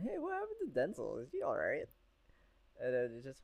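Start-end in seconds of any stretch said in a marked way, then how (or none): 0.0-1.9 high quoted question. Retold or imitated. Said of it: "I started asking Brian".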